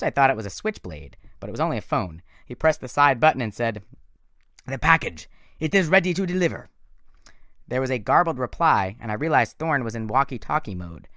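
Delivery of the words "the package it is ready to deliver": loud, high sinister villain voice; menacing villain. A narrator's reading. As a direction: command them like a cold monster. Thorne on phone.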